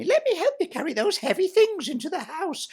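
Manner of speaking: high sugary simper; a simpering imitation of a goody-goody stepsister; mock Cinderella. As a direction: play the sweet little helper, mocking. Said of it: "she'd say to me".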